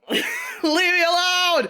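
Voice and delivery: high, slow, laughing plea to be left alone; laughing protest. Real, not acted. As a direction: whine it out through embarrassed laughter. Embarrassed by friends' teasing.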